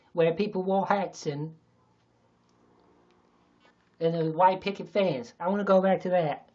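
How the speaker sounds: loud, slow, high Texan drawl; mock Texan political speech, drawn out; Texas accent. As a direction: rant nostalgically in a hammed-up Texas accent. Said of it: "I can do a Texas accent".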